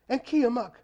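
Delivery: high worried call; anxious cry. A narrator's reading. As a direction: cry out for the boy. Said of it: "the old woman grandmother cried".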